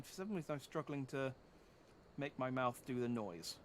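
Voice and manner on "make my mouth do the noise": ordinary talk, nothing marked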